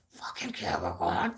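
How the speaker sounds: soft, whispered swearing aside; hushed grumble to oneself; whispered curse. Real, not acted. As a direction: whisper the curse under your breath. Muttered aside cursing a star sign.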